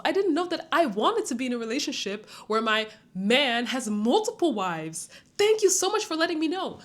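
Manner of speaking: high, breathless fake gratitude; hammed-up, high-pitched delighted swooning; mock gushing girlfriend. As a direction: gush sarcastically like an adoring girlfriend. Sarcastic imagined gushing girlfriend reply.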